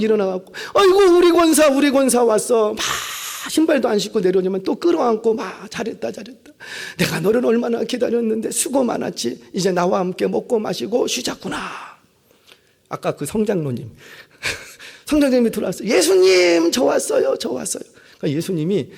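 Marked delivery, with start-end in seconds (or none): 0.7-11.6 joyful welcome. Retold or imitated. Jesus embracing the deaconess in heaven, delighted. 15.9-17.8 much higher and louder call, fading; self-important arrival. Retold or imitated. Proud elder calling out to Jesus on arrival.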